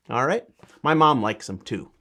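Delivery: ordinary delivery, nothing marked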